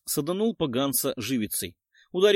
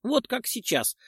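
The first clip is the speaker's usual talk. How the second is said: plain reading voice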